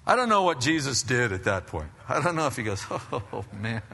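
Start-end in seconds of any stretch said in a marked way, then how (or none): 2.9-3.8 laughing "oh, man", acted out; mock laughing. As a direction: chuckle it out softly, as if amused. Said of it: "and started laughing".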